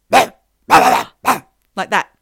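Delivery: gruff barking voice. Shown as a demonstration. Voicing the poltergeist for a friend, gruff barking. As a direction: growl it out like a barking entity. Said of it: "a gruff barking".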